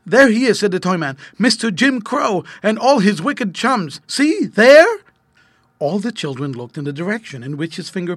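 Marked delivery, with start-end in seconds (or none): 0.0-0.6 high, loud exclamation; excited pointing out. A narrator's reading. Toy man points out the thief. 1.4-5.0 high, loud exclamation; excited pointing out. A narrator's reading. Toy man points out the thieving crows.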